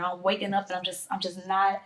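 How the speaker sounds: ordinary talk, nothing marked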